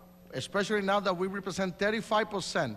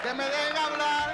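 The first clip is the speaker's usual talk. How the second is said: much higher, insistent; heated protest; 8 semitones higher than usual. Real, not acted. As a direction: demand the floor once more. Repeats protest in Spanish.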